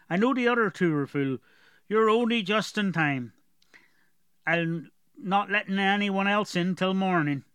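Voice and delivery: a brusque gate guard questioning travellers; gatekeeper. Acted. Guard voiced.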